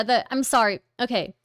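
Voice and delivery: usual speaking voice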